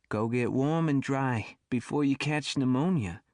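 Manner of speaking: put-on old Brooklyn lady's accent; thick Brooklyn accent. A narrator's reading. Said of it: "in a thick Brooklyn accent".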